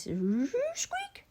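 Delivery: a sudden high squeak; eerie little squeak. Acted. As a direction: squeak like a creaky hinge. Strange noise in the dark.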